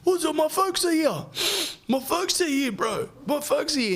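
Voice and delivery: very high, squealing mock panic; hammed-up falsetto alarm; mate caught out. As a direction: panic as your parents walk in. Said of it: "And he's like".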